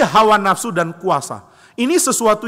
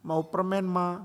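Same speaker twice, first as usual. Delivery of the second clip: soft, pleading little plea; small, pouty mock pleading; pitiful child; 9 dB quieter, slower than usual. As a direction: imitate a child begging sweetly. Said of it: "tunjukkan muka memelas".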